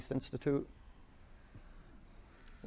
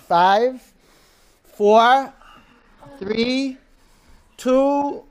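counting down loud and higher, building; loud countdown; 11 semitones higher, 14 dB louder, much slower than usual. Acted. Instructor counting the class down loudly. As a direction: call each number louder. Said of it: "countdown in class".

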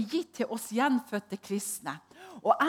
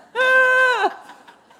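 a loud high burst of laughter; 13 dB louder than usual. Real, not acted. Preacher laughing at her own story to the congregation, loud high laugh.

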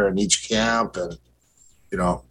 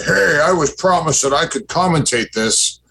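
mock complaint; 6 semitones higher than usual. Fighter imagining himself demanding the promised mic, mock complaint. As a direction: act out an entitled objection you would never make.

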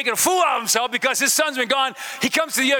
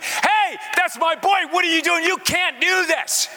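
high-pitched, urgent acted yell; worked-up shout, pitched way up; 8 semitones higher than usual. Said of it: "he's yelling and he's screaming".

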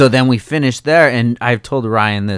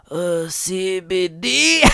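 slow, high, softer mock of the officer; 8 semitones higher, 5 dB quieter, much slower than usual. Mocking the officer puzzled by the oil, slow and high. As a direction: mimic her puzzled question, drawn out. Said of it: "she says".

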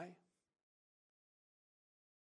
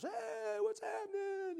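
much higher, exuberant imitated friend; hammed-up greeting; 3 dB louder, slower than usual. Jesse greeting the visiting couple, imitated much higher and bright. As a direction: squeal it like an overjoyed host.

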